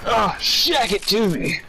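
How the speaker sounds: high, slow mock Austin Powers line; Austin Powers impression. Quoting the movie to a friend, a slow high Austin Powers voice. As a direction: ham up the swinging spy, slow and high. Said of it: "and then he's like".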